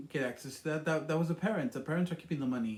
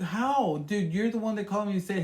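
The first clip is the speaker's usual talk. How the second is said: higher, louder protesting voice; a raised, louder indignant protest; indignant retort; 6 semitones higher, 5 dB louder than usual. Retold or imitated. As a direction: push back at him in disbelief. Retold angry retort to fiance.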